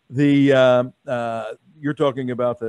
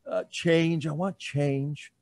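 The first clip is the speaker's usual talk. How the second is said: slow, hesitant voter; 6 dB quieter, much slower than usual. Retold or imitated. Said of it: "Biden, why?".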